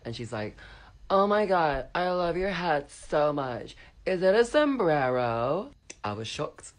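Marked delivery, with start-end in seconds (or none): 1.1-5.7 put-on high, loud, drawn-out drunken gushing; mock drunk-girl squeal, slow and high. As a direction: gush like a tipsy party girl, loud and stretched.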